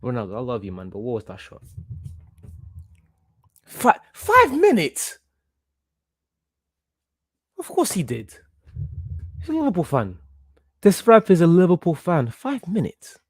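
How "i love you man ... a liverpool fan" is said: ordinary talk, nothing marked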